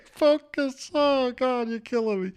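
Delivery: high squeal through laughter; helpless laughter. Real, not acted. Host cracking up at Laughing Larry, high helpless laughter. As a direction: laugh it out, breaking up.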